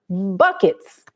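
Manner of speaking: ordinary talk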